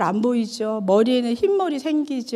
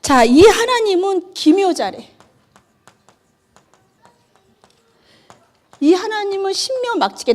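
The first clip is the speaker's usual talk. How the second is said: fervent preaching; 7 semitones higher than usual. Real, not acted. Preacher exalting God.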